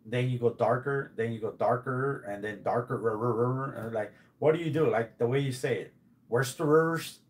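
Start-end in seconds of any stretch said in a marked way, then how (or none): none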